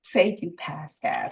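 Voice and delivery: slow, prim and low-voiced; prim disapproving mother. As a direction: correct them slowly, like a prim mother. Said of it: "she's like".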